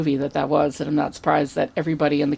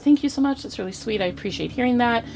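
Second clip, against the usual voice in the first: lightly voiced appreciative actress, higher; a hint of a sweet, higher voice; gracious star; 6 semitones higher, 3 dB quieter, faster than usual. Actress thanking an adoring fan, lightly imitated as gracious.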